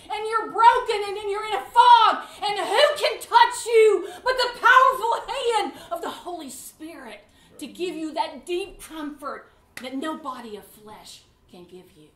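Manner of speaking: usual speaking voice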